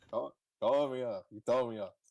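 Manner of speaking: usual speaking voice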